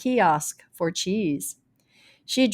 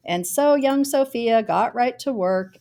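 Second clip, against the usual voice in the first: plain reading voice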